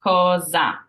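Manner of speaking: cosa with a buzzing z; Z sound. Shown as a demonstration. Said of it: "the Z zipper sound".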